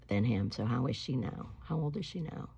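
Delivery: ordinary delivery, nothing marked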